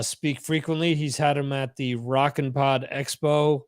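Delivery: usual speaking voice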